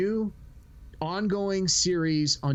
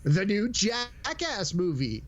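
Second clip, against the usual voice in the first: bright higher salesy voice; mock Netflix pitch; 7 semitones higher, slower than usual. Imitating Netflix pushing a new movie, mock salesy. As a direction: pitch it like a streaming ad, lifting up. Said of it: "it's like".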